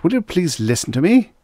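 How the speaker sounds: high, exasperated demand; snapping. A narrator's reading. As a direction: insist on being heard. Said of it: "Cal snapped".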